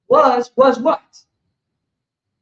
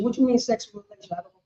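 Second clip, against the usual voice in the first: quiet, fast, lightly voiced evasive answer; mock evasive reply; 3 semitones lower, 8 dB quieter, faster than usual. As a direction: feign innocence, softer. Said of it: "This is how they talk".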